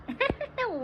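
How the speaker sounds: a sharp, high laugh that falls away; quick high giggle; high burst of laughter. Real, not acted. Streamer laughs at chat.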